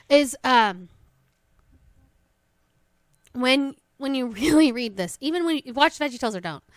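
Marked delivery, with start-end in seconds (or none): none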